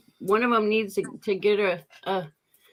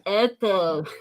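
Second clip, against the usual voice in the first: saying "Apple" while holding your tongue; tongue held, word comes out garbled; holding tongue with fingers. Shown as a demonstration. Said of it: "That's what you just did".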